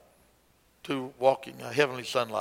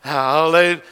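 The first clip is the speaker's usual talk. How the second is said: rough transition demo; 4 semitones higher, 12 dB louder than usual. Shown as a demonstration. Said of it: "it's rough".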